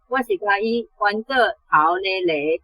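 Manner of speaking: ordinary delivery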